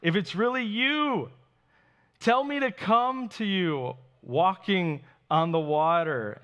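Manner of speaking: calling out. A narrator's reading. Peter to Jesus from the boat, calling out. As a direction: call out earnestly across a distance. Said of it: "Then Peter called to him".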